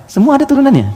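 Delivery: high, loud emphatic burst; emphatic exclaim. Real, not acted. Preacher driving home to the congregation, a high loud exclamation. Preacher stresses every case covered.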